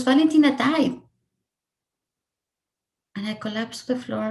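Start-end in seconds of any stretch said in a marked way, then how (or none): 0.0-1.0 retold crying news. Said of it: "she looks at me crying".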